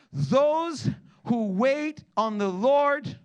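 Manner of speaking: slow, emphatic preached quote; slow scripture reading. Acted. Pastor reading Isaiah to the congregation, slow and emphatic. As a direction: draw out each word of the prophet.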